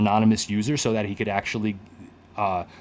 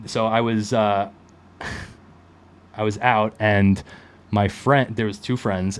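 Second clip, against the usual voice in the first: ordinary delivery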